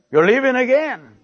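imitating a wife's loud, high-pitched complaint. Preacher re-enacts his wife catching him going out, loud. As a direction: raise voice and pitch sharply as the fed-up wife. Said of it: "She said it loud".

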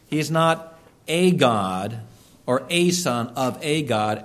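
normal talking voice

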